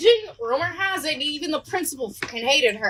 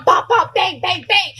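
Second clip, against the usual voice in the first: spoken bangs, a bit louder; imitated door banging; 5 dB louder than usual. Kids banging on classroom doors, spoken bangs. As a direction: knock knock on the doors.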